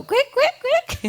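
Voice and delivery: very high, squeaky bird calls; squawking birds. Retold or imitated. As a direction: squawk back like scolded birds. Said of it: "they go".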